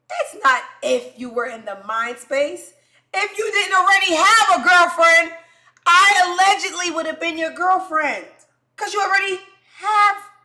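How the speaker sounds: loud, high outraged rant; indignant shouting. Acted. Confronting the guy about his girlfriend, shouting. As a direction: yell it in wounded exasperation. Mock outburst at cheating man.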